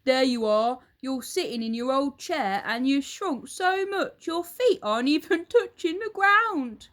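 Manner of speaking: loud, high, triumphant yell; Mr Twit shouting. Acted. Husband shouts in triumph at his shrinking wife. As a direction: crow it at her. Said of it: "pointed at her and shouted".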